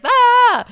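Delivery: high, loud hammed-up shriek; mock scream. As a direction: yelp in mock terror. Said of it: "he's just like".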